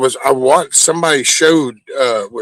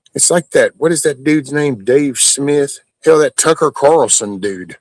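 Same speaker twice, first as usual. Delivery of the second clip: ordinary talk, nothing marked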